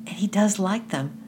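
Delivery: a little lift of delight; thrill of happiness. A narrator's reading. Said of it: "with a little thrill of happiness".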